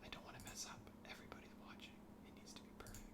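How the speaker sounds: tense whisper under the breath. Real, not acted. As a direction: whisper it like everyone's watching. Nervous aside while drawing carefully.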